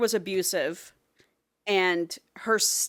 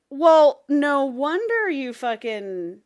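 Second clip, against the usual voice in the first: louder, higher, slow; exasperated; 7 semitones higher, 6 dB louder, much slower than usual. Retold or imitated. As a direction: blurt it out exasperated. Acted exclamation.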